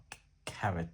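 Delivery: Careth retried, ending in th; a second, tentative go at Careth with final th; Welsh-style th ending. Shown as a demonstration. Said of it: "comes out as a th sound".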